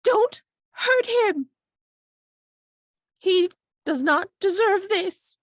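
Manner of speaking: high, loud, protective woman's cry; shrill, indignant pleading. Acted. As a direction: shriek it slowly and emphatically in falsetto. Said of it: "a very shrill voice going".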